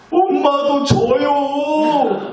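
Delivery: hammed-up wail, high and stretched out; bawling child. Little child answering scolding mother, mock bawling. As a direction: wail it like a kid, dragging every syllable. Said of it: "막 펑펑 울면서 이렇게 얘기했다는".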